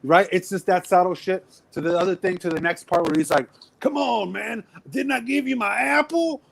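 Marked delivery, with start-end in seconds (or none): 3.8-6.4 movie quote voice. Retold or imitated. Retold movie quote, pitch up.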